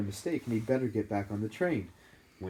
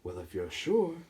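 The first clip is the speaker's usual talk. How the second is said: lightly voiced slow persuading hat; hat tries to sway Harry; small hat voice; 2 semitones higher than usual. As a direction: tempt him, then give in. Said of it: "said the small voice".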